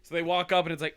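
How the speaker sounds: usual speaking voice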